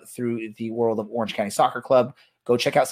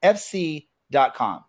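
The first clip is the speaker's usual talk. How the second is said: higher, upbeat commercial read; ad announcer voice; 7 semitones higher than usual. Shown as a demonstration. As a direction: sell it like a radio ad. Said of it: "This is the way it works".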